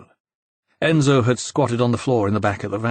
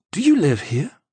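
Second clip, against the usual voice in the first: incredulous; 8 semitones higher than usual. A narrator's reading. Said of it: "He sounded incredulous".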